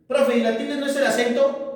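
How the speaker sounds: high, quick pupil challenge; student asking. Acted. Teacher voices a student's question. (voz aguda y rápida de alumno que duda)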